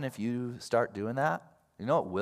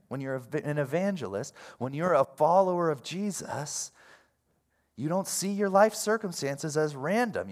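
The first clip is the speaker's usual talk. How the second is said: usual speaking voice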